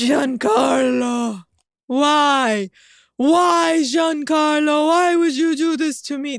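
anguished wail, high and drawn out; betrayed and heartbroken. Acted. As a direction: cry out as if stabbed in the back. Mock anguished plea to Giancarlo.

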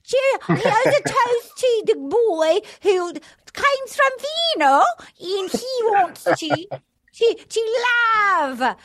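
high, slow, hammed-up bad British accent, laughing through it; a deliberately terrible British accent, giggling as she raises a toast; mock British accent. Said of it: "she did a toast with a bad accent".

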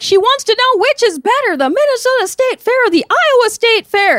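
high, put-on senator voice repeating the question; imitating Amy Klobuchar. Narrator mimics the senator relaying the question, high put-on voice. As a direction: play the senator stalling for the crowd. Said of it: "And Amy Klobuchar was like".

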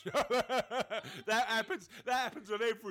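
laughing through the words. Real, not acted. Laughing at co-host's nonsense.

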